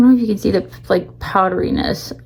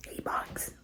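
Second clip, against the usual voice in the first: whispering; 21 dB quieter than usual. Real, not acted. Whispered repeat.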